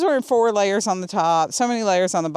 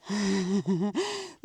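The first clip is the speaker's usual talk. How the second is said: delighted laugh; 6 dB quieter than usual. Real, not acted. Delighted at snow news.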